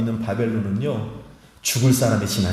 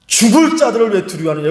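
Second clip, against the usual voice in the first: crying out; 8 semitones higher, 5 dB louder than usual. Acted. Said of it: "뭐라고 말씀".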